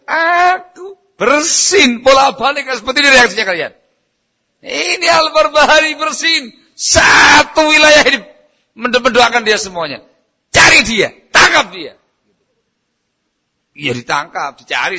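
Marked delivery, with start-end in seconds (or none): 0.1-11.9 high, loud tirade of an enraged ruler; acted angry ruler. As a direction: shout it as an outraged ruler ordering his men. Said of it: "Marah, emosi". (menirukan penguasa yang berteriak murka)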